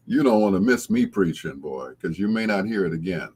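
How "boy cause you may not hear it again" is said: ordinary delivery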